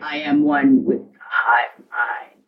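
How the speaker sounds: very low, slow monotone; hive-mind drone. Acted. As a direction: drone it like a mind-controlled follower. Echoes the brainwashed huntress.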